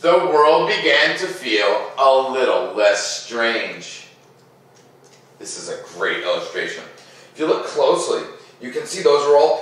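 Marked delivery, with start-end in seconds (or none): 0.0-4.1 put-on gentle read-aloud to children; storybook narration. A narrator's reading. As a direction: tell it like a bedtime story. Reads picture book aloud.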